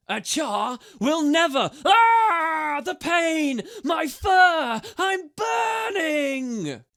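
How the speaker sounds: high, loud cries of mock agony; crying out in pain. A narrator's reading. Ember fakes agony for the listening guards, screaming. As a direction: scream as if your fur is on fire. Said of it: "cried Ember".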